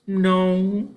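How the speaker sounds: put-on high, small child's answer; a short shy no; girl's voice. A narrator's reading. Ellen answering the farmer, high child voice.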